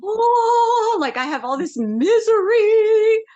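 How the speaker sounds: high, loud moaning dog voice; sorrowful beagle voice. Retold or imitated. Woman voicing the lonely beagle's misery, high wailing moan.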